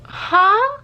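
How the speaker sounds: mock confusion. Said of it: "was like".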